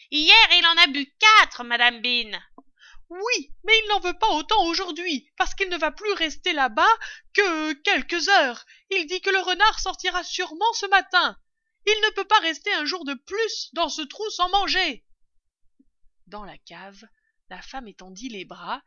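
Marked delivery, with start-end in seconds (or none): 0.1-2.3 very loud, high call dropping at the name. A narrator's reading. Servant in the cellar answering madame Bean, calling up. 3.1-15.0 loud, high, sustained holler; calling down. A narrator's reading. Mistress telling the servant about the fox, calling down.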